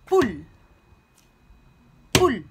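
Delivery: Poule lancé très fort en un seul coup; syllabe frappée. Shown as a demonstration. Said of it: "frapper deux fois dans la main". (maîtresse qui scande Poule d'un seul coup pour les élèves)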